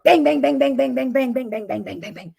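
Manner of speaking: loud knocking that fades away; spoken door bangs, loud then dying down; imitated knocking. Telling the story, bang bang bang fading out. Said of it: "knocks on the door".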